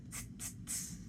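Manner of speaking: soft unvoiced tss, tss, tss; hissing s sounds. Shown as a demonstration. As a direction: hiss the S's quietly, like air escaping. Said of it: "We got the S's going".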